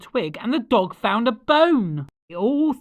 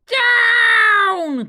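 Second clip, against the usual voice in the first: high, loud shout of down; cried down; 12 semitones higher, 7 dB louder than usual. Acted. Witch ordering the broom to land, shouting. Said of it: "cried the witch".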